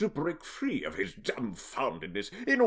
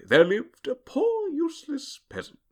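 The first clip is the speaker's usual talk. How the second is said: storybook narrator; 11 semitones higher than usual. A narrator's reading. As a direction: read it like a fairy tale.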